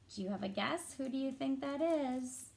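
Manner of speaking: usual speaking voice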